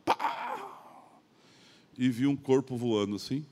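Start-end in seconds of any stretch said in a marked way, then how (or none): 0.1-1.1 high-pitched "pá" of an impact; imitated crash. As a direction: imitate the bang of a collision. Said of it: "só escutei a pancada". (imitação de pancada)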